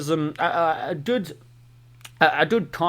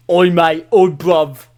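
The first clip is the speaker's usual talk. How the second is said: loud, hammed-up British street accent; loud mock British "oi" challenge; 9 dB louder, much slower than usual. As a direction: call out like a mock British lad. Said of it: "you wanna hear my British accent".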